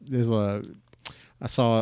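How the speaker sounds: normal talking voice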